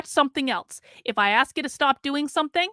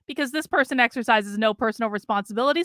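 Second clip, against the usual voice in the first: usual speaking voice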